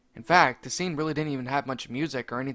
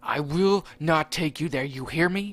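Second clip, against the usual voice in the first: furious yelling; 3 semitones higher, 8 dB louder than usual. Acted. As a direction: bellow out the punishment. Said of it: "Mario yelled".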